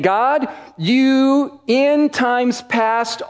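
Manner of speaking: ordinary talk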